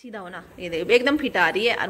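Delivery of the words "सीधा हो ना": soft repeat. Real, not acted. Mother softly urges her daughter to stand straight. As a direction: say it under your breath now.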